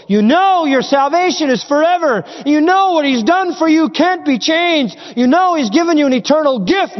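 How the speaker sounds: high, ringing preacher's declaration; impassioned high-pitched sermon delivery; full-force preaching. Acted. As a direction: drive each assurance home from the pulpit.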